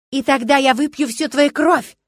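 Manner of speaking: loud, high horror-film roar; wild yell. A narrator's reading. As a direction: bellow like a movie vampire. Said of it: "раздался дикий вопль". (дикий киношный вопль)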